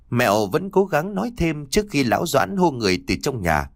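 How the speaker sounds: usual speaking voice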